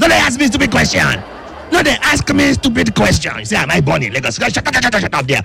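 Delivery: high, outraged Pidgin outburst; Brown Chris. Pretend. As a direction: protest shrilly high in thick Nigerian Pidgin. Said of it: "Brown Chris".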